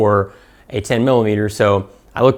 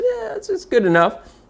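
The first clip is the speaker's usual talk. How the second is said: shrugging engineer. Retold or imitated. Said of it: "a Glock engineer ... and said".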